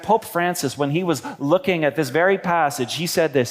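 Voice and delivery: usual speaking voice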